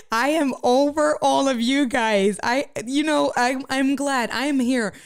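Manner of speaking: playfully fed up with everyone; mock exasperation. Real, not acted. Mock fed up with laughing viewers.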